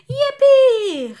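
hoher, sehr lauter Freudenschrei; jubelnd. Acted. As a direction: jubel wie begeisterte Kinder. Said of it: "riefen die Kinder". (Kinder jubeln, weil der Löwe bleiben darf, laut)

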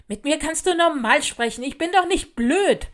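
loud, high, angry retort; indignant snapping. A narrator's reading. New girl tells off a classmate, snapping angrily. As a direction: snap back, offended and loud. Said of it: "fauchte sie mich an".

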